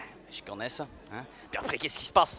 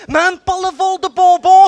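much higher and louder; exasperated shout; 9 semitones higher, 9 dB louder than usual. Acted. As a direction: blow up at the last item. Cop escalating his rant.

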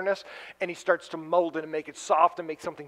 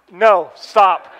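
loud protest; 11 dB louder than usual. Real, not acted. Audience reacts to Seahawks.

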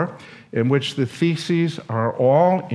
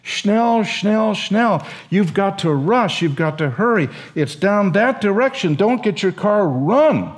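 urgent German professor; 6 semitones higher than usual. Retold or imitated. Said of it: "he said to us".

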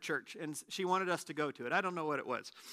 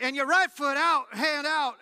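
higher and louder, calling out the hokey pokey steps; mock hokey pokey call; 6 semitones higher, 7 dB louder than usual. Joking to his brother during the church service, calling out the hokey pokey. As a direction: sing out the dance moves like a joke. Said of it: "And I'm like".

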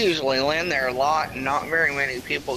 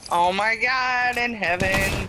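panicking gamer; 7 semitones higher than usual. Real, not acted. Relief after tense fight.

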